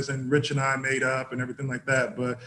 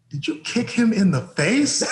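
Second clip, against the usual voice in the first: incredulous; 6 semitones higher, slower than usual. Retold or imitated. Coach retold, incredulous scolding.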